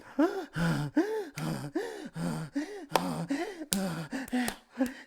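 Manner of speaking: long high panting gasp; breathless mock gasping; imitated panting gasps. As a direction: pant along in time with the beat. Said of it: "And she's just".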